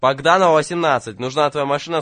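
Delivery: loud, urgent repeat of the address; urgent shout. Acted. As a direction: call the address out loud to be heard.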